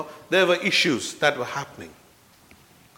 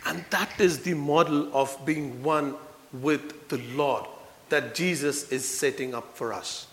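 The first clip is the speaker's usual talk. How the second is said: normal talking voice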